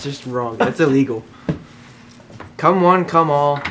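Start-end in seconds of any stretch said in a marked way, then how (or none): 2.6-3.6 slow, loud barker call; slow announcer call. Acted. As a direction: draw out the invitation like a barker.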